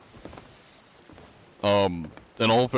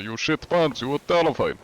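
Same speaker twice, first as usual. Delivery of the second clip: higher hammed-up Russian accent; 6 semitones higher than usual. Streamer tells chat why people fear his Russian man, higher Russian accent. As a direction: keep the pitched-up Russian man voice going. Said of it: "when I do the Russian accent".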